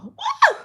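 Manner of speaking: very high-pitched woo; emotional whoop. Real, not acted. Host after a moment of silence, emotional high whoop. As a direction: let out a sudden high whoop to shake off feeling. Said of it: "Woo, I get emotional".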